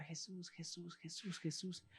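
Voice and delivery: faint, far-off calling of Jesús; distant crowd calls. Acted. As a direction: call Jesús as if from far away. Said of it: "Y empiezan a gritar".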